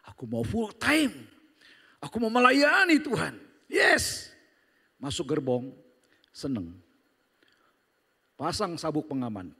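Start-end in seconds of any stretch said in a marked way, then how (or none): 0.1-4.3 eager believer. Retold or imitated. Eager new believer voiced.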